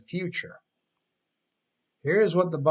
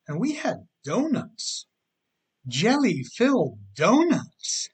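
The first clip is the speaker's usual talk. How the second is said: giddy, higher, slowing down on jelly-filled donuts; excited; 7 semitones higher, 5 dB louder, slower than usual. Retold or imitated. On-time employee bragging to a latecomer, excited. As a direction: gush like the treats were the best part. Coworker raving about missed meeting.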